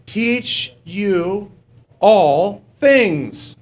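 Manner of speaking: slow, word by word, drawn out; leading a read-along. Shown as a demonstration. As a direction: lead the congregation reading aloud, each word stretched. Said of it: "Read this with me".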